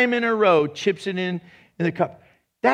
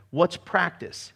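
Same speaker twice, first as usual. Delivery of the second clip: usual speaking voice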